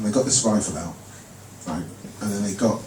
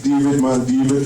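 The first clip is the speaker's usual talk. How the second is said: a light hint of a Jamaican mum; lightly put-on Jamaican mum's patois; mum's Jamaican accent. Comic telling his mum's words, light Jamaican accent. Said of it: "that's how she speaks".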